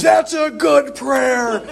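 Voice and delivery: high, slow, laughing retort; an old priest's booming laughing reply; mock bold old priest. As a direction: shout it back with a laugh, half-hammed. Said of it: "And he goes".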